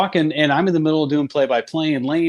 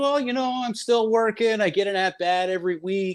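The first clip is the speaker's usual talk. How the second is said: higher, deadpan fib; lightly voiced old broadcaster, pitched up; teasing Uke; 6 semitones higher than usual. Uke kids Willie Mays that he still gets at-bats, higher voice. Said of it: "He goes".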